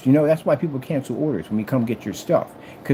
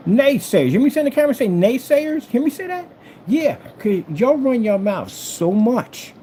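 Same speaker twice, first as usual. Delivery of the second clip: calling out; 9 semitones higher than usual. Real, not acted. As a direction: shout it up at the naysayers, daring them. Calling out naysayers to camera.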